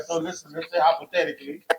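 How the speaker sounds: usual speaking voice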